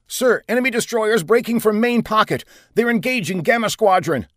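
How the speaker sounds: high, alarmed officer; urgent warning. A narrator's reading. Bridge officer warning commander of destroyers, urgent. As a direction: report the attack urgently.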